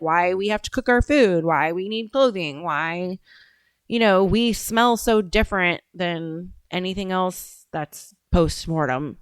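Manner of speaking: normal talking voice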